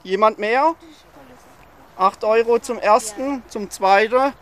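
high, carrying auction call; auctioneer call. Real, not acted. Auctioneer calling out bids.

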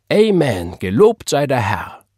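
loud, high cry of praise; called out. A narrator's reading. Praying mother praising the Lord for the boy, calling out. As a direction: exclaim it fervently like a congregation's response. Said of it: "rief Praying Mother Washington".

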